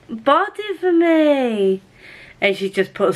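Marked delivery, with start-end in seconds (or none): 0.1-1.8 touched squeal. Real, not acted. Touched friend bought book.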